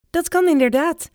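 light up with enthusiasm; enthusiastic. Acted. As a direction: professor answers grandpa with a high, eager yes. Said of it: "zegt de professor enthousiast".

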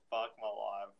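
ordinary talk, nothing marked

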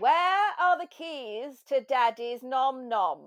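slow, loud, plummy grande dame; Maggie Smith impression. Quoting a film for a friend, a slow loud grand dame. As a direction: drawl it like an aging theatre dame. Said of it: "Maggie Smith, is talking about".

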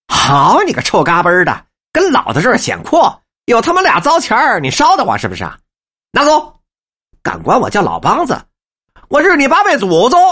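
high, loud furious cursing; raging curse. Acted. Old man raging at the messenger, loud furious cursing. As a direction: stamp and roar the insults. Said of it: "跳着脚大骂".

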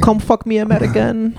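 high, hammed-up Dutch accent; a hint of a Dutch accent. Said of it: "It's not really a Dutch accent".

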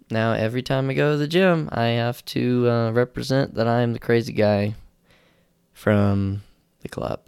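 ordinary talk

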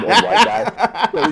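cracking up mid-sentence; laughing. Real, not acted. Recalling favorite substitute teacher, laughing hard.

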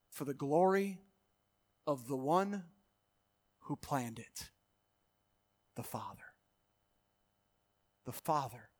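dropping down to a hush; hushed awe. Real, not acted. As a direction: let it sink into reverent quiet. Sudden drop to hush.

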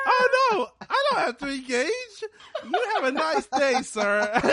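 high, laughing smug relief; smug realisation. Retold or imitated. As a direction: drop it with breezy privilege. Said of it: "He's like".

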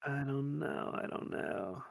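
unvoiced, barely audible; whispering. Real, not acted. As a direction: whisper it, embarrassed. Put on the spot, mumbling.

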